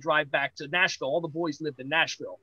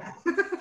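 embarrassed laugh; 12 semitones higher, 4 dB quieter than usual. Retold or imitated. Embarrassed in ring, crowd laughing.

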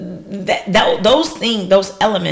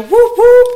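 high, loud cheering whoops; two piercing party whoops; celebratory whoop; 10 dB louder than usual. Real, not acted. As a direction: whoop it up for a shout-out. Cheering a shout-out.

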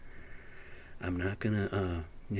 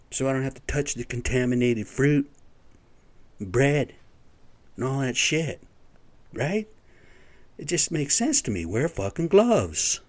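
heated rant; 4 semitones higher, 4 dB louder than usual. Real, not acted. Heated rant about gloves.

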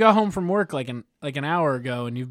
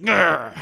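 playful growl; 2 semitones higher, 5 dB louder than usual. Pretend. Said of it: "I'm just like".

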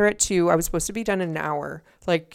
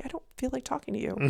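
soft, quick, higher-pitched brush-off; retold quiet refusal; 7 semitones higher, 13 dB quieter, faster than usual. Bride to the lady before the wedding, quietly refusing. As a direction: say it fast and under the voice. Curt quoted refusal to officiant.

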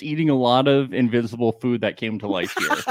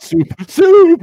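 hammed-up squeal, much higher, louder; mock horror; 9 dB louder than usual. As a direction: squeal the word in terror. Mock horror guessing soup.

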